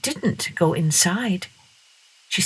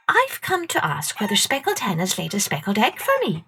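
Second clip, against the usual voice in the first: put-on polite girl; 3 semitones higher than usual. A narrator's reading. Masha asking Peter Cockerel about the egg. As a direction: ask timidly like a little girl.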